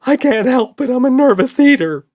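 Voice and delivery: high, loud blubbering wail; nervous monster blubbering. A narrator's reading. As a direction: sob it out with a full mouth. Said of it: "blubbered Red".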